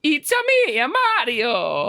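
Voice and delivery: put-on Mario accent. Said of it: "You got your line".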